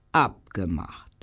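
usual speaking voice

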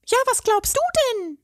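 very high fairy voice, scolding; a dainty voice telling him off. A narrator's reading. The fairy scolds the troll, high and indignant. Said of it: "schimpfte die zarte Stimme".

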